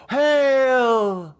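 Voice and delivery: high, ringing shout of hail; exultant cry. Acted. The cult cheering the sacrifice, high exultant hail. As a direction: cry it out in rapture. Cultists cheer the first cut.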